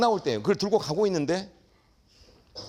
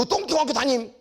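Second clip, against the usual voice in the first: sneering detective; 7 semitones higher than usual. Retold or imitated. Detective mocking the teacher from a dump school, sneering. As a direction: spit out the school's name with scorn.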